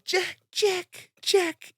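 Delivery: high, drawn-out mock wail of Jack; Titanic Rose calling Jack. Wheeled away on the gurney, calling to his roommate in a high mock-Titanic wail. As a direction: play the heartbroken heroine, way up high. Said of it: "like, Titanic hands".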